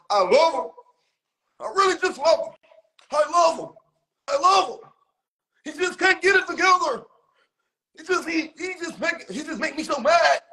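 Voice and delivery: high, mock-emotional voice; imitating a gushing complainer. Mimicking him going live about his love, high and overwrought. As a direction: pitch it way up in mock exasperated affection. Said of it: "talking about something".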